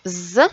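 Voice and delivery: "Z" as a held buzzing zzz; letter sound Z. Shown as a demonstration. Teacher explaining the S in rose, buzzed "Z". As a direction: sustain a voiced buzz on "Z". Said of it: "on a lu le S".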